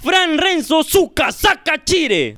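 slow, high announcer call; donor announcement. Real, not acted. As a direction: stretch the name out like an MC. Anuncia el nombre de un donante. (anuncio lento y agudo de locutor)

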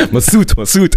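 Pokémon voice. Pretend. Host plays on the guest's Pokémon joke, mock Pokémon voice. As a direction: chirp the name like a Pokémon. Mimicking a Pokémon repeating its name.

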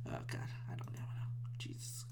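hushed, defeated muttering; despairing whisper. Real, not acted. Podcaster unsure if yelling was bad, despairing whisper.

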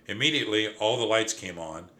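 ordinary delivery, nothing marked

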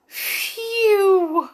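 high breath of relief; relieved phew. A narrator's reading. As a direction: sigh out relief. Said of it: "said Jack".

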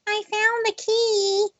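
high, slow ditzy sing-song; mocking ditzy voice. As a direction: mimic a clueless clerk, drawn out. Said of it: "here comes Miss Twinkle Toes".

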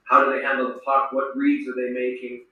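ordinary delivery

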